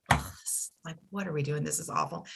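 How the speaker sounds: quiet, low groan of dismay; low despair. Retold or imitated. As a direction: sink under a wave of grief, voice dropped right down. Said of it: "big, deep waves of like".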